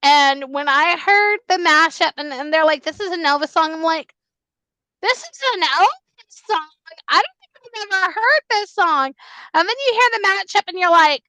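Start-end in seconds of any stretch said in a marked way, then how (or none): 5.0-9.1 incredulous. Retold or imitated. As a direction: react in stretched-out, high-pitched disbelief. Retells her disbelief at the news.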